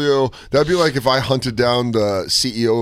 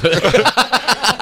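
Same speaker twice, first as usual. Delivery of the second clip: high laugh; 7 semitones higher than usual. Real, not acted. Laughing after story.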